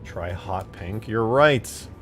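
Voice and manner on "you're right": usual speaking voice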